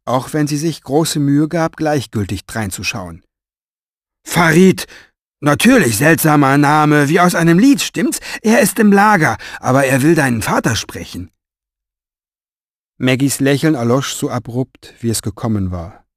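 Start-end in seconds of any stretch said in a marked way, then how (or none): 4.2-11.3 high, loud, blustering apology; flustered strong man. A narrator's reading. Strong man apologizing to Mo, high and loud. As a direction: babble it out, embarrassed and hungover.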